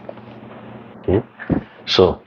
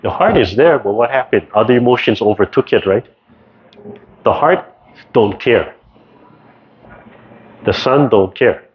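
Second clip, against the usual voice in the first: ordinary delivery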